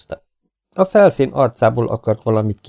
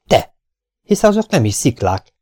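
a sudden excited cry, louder and higher; sudden exclamation; 5 semitones higher, 5 dB louder than usual. A narrator's reading. Mark spots strange machines on the Moon, crying out in astonishment. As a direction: blurt it out in amazement. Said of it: "hirtelen felkiáltott".

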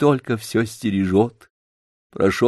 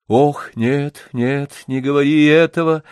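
plain reading voice

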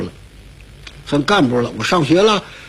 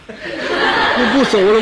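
refusing to move; 4 semitones higher, much slower than usual. Acted. Too scared to climb stairs.

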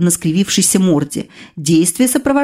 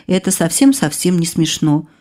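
plain reading voice

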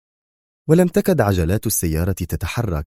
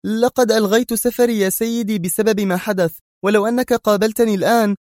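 high-pitched woman answering readily; quick reply; 14 semitones higher than usual. Acted. Mona explaining her cancelled trip to Bruce, hastily. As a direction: jump in with a hasty excuse. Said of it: "أجابته منى في سرعة".

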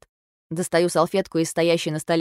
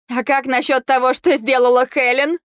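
higher, louder accusing cry; shout; 7 semitones higher, 6 dB louder than usual. A narrator's reading. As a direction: hurl the question across the room. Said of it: "кричу я".

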